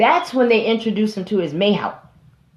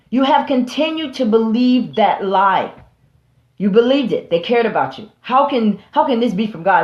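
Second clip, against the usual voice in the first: ordinary talk, nothing marked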